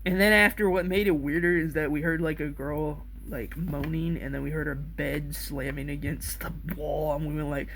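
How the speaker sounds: ordinary delivery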